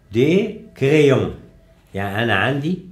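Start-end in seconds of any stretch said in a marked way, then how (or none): 0.1-1.3 "دي كريون" said slowly, each sound clear; slow careful French sounding; slow model word. Shown as a demonstration. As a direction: spell out "دي كريون" slowly for learners. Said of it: "جي".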